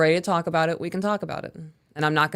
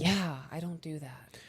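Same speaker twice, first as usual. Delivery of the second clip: whisper; 12 dB quieter, slower than usual. Real, not acted. Quiet aside about arguing.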